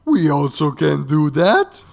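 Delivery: loud, slow, high mock boast; hammed-up "me too" voice; eager copycat. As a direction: announce it like an over-eager imitator. Voices DC eagerly copying Marvel.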